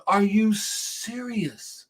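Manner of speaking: slow, high, stunned "are you serious"; disbelief. Retold or imitated. To the worker closing early, slow stunned disbelief. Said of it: "I'm looking at this dude going".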